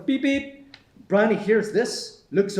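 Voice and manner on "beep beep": high-pitched spoken horn beeps; car horn imitation. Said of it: "He beeps the horn".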